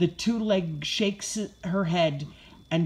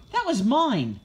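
higher-pitched puppy complaint, falling at the end; barked protest; 5 semitones higher than usual. A narrator's reading. Puppy robbed of a treat, barking. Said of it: "I bark".

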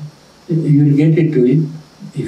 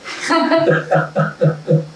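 rippling laugh, pitched up; higher, amused chuckling; real laugh; 5 semitones higher than usual. Real, not acted. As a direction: laugh in a run of short bursts. Laughter during the lesson.

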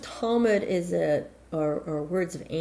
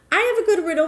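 eager guest; 12 semitones higher, 7 dB louder, much faster than usual. Acted.

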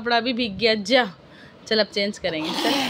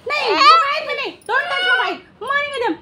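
mock scolding; 12 semitones higher, 4 dB louder than usual. Real, not acted. Mother playfully scolding her baby for grabbing the phone, high mock threat.

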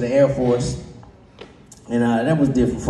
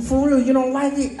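high question; 12 semitones higher than usual. Retold or imitated. Woman's question acted.

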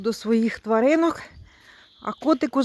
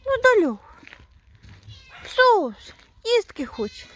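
higher cooing voice for the pet; pet talk; 9 semitones higher than usual. Real, not acted. Woman greets her pet Dalia in a higher cooing voice. Coos to the animal.

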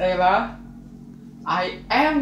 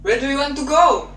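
quick, raised urgent question; urgent call; 6 semitones higher, 5 dB louder, faster than usual. Acted. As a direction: call after her, fast and loud. Prince calls after fleeing Ella.

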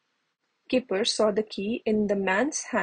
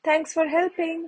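a higher, lightly raised call while riding away; shouted over shoulder; 6 semitones higher than usual. A narrator's reading. Young man riding off, calling thanks to the children. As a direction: toss the thanks back as you ride off. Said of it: "he shouted over his shoulder".

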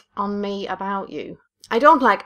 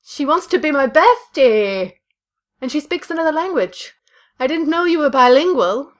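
excited; 6 semitones higher, 5 dB louder than usual. Pretend. Excited about bestie.

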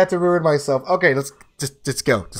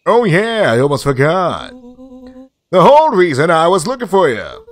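lightly voiced, animated game character; 5 semitones higher, 5 dB louder than usual. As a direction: play the friend with eager news, lightly hammed-up.

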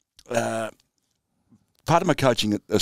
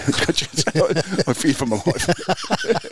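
slow and giggly; real laughter; laughing; 7 semitones higher, slower than usual. Real, not acted. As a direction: laugh the line out. Laughing at the end of the story.

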